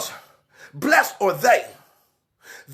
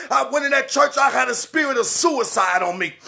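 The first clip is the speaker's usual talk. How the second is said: normal talking voice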